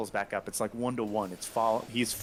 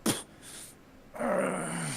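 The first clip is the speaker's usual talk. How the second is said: straining goliath; 8 semitones higher, 4 dB quieter, slower than usual. Acted. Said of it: "the Goliath straining".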